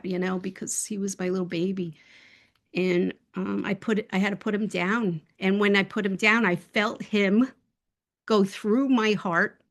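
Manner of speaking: plain reading voice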